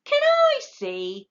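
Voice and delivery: very high, slow, tiny voice; small voice. A narrator's reading. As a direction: peep up shyly. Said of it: "said a small voice".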